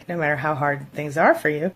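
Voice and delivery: usual speaking voice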